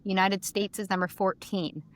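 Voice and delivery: normal talking voice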